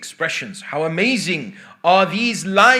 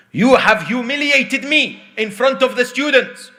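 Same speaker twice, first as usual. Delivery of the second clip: high, raised-voice rebuke; acted outrage, pitched up high; indignant teacher; 6 semitones higher, 4 dB louder than usual. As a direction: scold the pupil with wounded authority.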